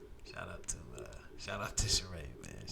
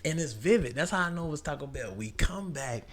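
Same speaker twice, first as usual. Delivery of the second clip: normal talking voice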